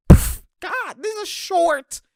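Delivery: high, slow cry of dismay; drawn-out high exasperation. Real, not acted. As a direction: wail as the shot falls short. Groaning at a missed shot.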